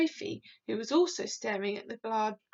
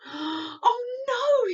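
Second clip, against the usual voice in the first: high loud alarmed cry; dismayed cry; 12 semitones higher, 7 dB louder than usual. A narrator's reading. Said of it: "he cried".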